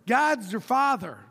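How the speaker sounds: ordinary talk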